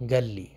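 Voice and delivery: گال لی with the qaf turned into a g and the alif blurred; colloquial Arabic. Shown as a demonstration. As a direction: say قال لی the street way, as گال لی. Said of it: "قاف کو وہ گاف بولتے ہیں".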